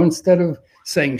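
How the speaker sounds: usual speaking voice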